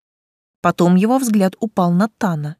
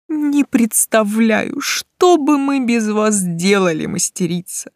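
effusive praise; 4 semitones higher, slower than usual. A narrator's reading. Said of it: "не переставал распинаться он".

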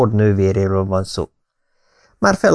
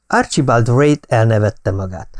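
usual speaking voice

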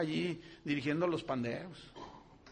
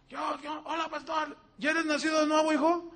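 imitating eager kids; 10 semitones higher than usual. Kids greet the pastor.

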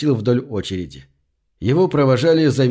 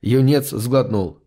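ordinary delivery, nothing marked

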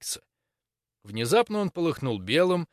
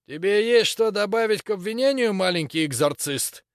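creaky voice from helmet; 8 semitones higher, 4 dB louder than usual. Acted. Grok questions from under visor.

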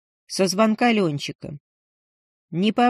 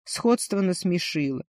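ordinary delivery, nothing marked

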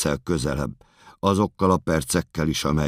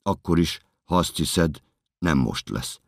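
ordinary talk

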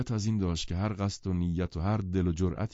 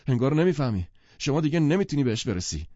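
higher and louder, slightly alarmed; anxious insistence, raised; a bit alarmed; 8 semitones higher, 6 dB louder than usual. Acted. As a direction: push back with nervous urgency. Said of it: "گتسبی کمی هراسان گفت".

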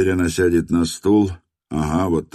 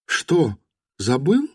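lightly voiced young woman, wary; wary wife; 9 semitones higher, slower than usual. A narrator's reading. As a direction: ask it warily, a bit higher. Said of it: "насторожилась Настя". (настороженно, женским голосом)

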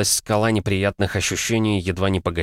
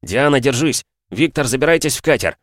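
high, loud urgent call; shouting; 6 semitones higher, 4 dB louder than usual. Acted. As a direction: call out over the noise. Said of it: "послышался крик".

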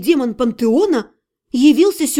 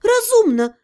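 agreeing; 8 semitones higher than usual. A narrator's reading. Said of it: "согласилась я".

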